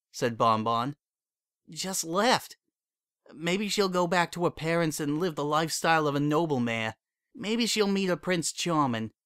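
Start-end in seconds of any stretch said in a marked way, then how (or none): 1.7-9.1 higher mare voice, bitter and resigned; bitter Bon Bon. A narrator's reading. Jilted mare sneers about Lyra's noble life, bitter. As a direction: spit out the noble life with scorn.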